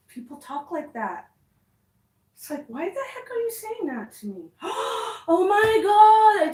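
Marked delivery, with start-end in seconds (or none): 4.5-5.2 sharp gasp. Real, not acted. Discovers damage in apartment. 5.3-6.5 shocked. Real, not acted. Discovers damage in apartment.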